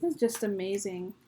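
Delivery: ordinary talk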